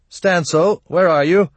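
worried call, rising at the end. A narrator's reading. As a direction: call loudly into the dark for someone. Father calls again for missing son.

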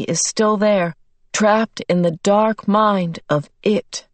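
slow, deliberate, every word set apart; a father speaking slowly and distinctly to the beasts. Acted. Mister Murray speaking slowly and distinctly to the beasts, measured and grave. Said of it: "slowly and distinctly".

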